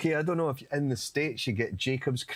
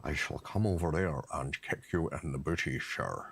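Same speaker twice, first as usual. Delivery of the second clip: lightly voiced Scottish spy, low; a hint of Connery's slushy Scottish; Sean Connery voice; 9 semitones lower, 5 dB quieter than usual. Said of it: "Do it in a Sean Connery voice".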